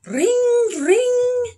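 very high, loud "ring"; piercing held telephone sound effect; telephone ring imitation. A narrator's reading. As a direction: trill it like a ringing phone. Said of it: "starting my story with the sound effect".

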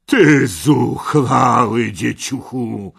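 loud, slow, powerful bellow; thundering voice. Acted. Red knight scorns Parsival, thundering slowly. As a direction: thunder down at an insolent child. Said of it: "potężnym głosem zagrzmiał".

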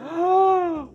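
loud high gasp of shock; sharp gasp. Real, not acted. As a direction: gasp in sudden alarm. Sudden shock in game.

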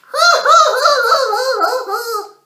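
high, loud gleeful cackle; cackling child laugh. Acted. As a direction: laugh like a mean little boy. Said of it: "Laughs Alfie".

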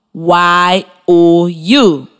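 Y O U drawn out slowly, letter by letter; spelling out. Shown as a demonstration. Teacher to young students, spelling very slowly. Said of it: "it is spelled".

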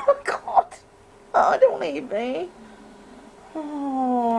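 high nervous laugh, then plea. Real, not acted. Scared in the dark.

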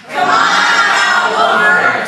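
leading a crowd shout to call the puppet out; full shout. Shown as a demonstration. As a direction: bellow it out with the crowd, dragging each word. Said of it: "as loud as you can".

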